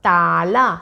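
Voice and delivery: ताला, pronounced carefully and distinctly; word demo. Shown as a demonstration. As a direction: model the word ताला for a child. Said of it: "जैसे".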